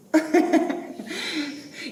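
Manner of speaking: light laugh breaking up high. Real, not acted. Moderator laughing after the session pitch, high laugh. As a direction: giggle briefly, high.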